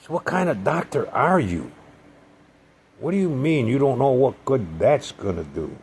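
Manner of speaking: high, loud indignant challenge; reliving the argument with the doctor, loud and high; retold angry retort. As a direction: fire the question back at him, outraged.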